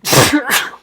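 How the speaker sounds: loud sudden sneeze; one sharp real sneeze; sneezing. Real, not acted. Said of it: "oh, sorry, I'm gonna sneeze".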